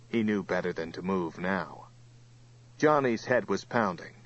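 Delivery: ordinary delivery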